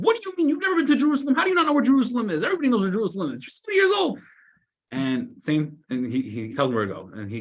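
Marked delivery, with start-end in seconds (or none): 0.0-4.2 high, loud, fast outraged yell; passerby yelling at an old man who doesn't know Jerusalem; yelling in disbelief. Retold or imitated. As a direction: scream at him like he's crazy. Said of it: "the guy starts yelling at him".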